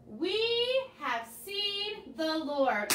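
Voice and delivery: put-on each word drawn out for children to repeat; slow call-and-response line. Shown as a demonstration. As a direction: say it slowly so the kids can follow. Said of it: "One more time".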